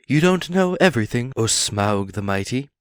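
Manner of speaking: a small hero standing up to a dragon; brave hobbit voice. Acted. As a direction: Bilbo plucking up courage to answer Smaug. Bilbo addresses the dragon.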